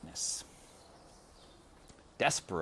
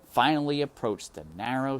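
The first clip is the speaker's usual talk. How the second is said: ordinary delivery, nothing marked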